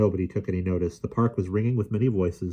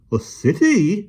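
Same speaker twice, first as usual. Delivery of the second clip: exclaiming; 13 semitones higher, 6 dB louder, slower than usual. A narrator's reading. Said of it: "exclaimed the policeman".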